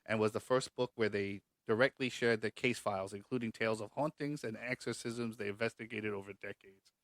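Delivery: ordinary delivery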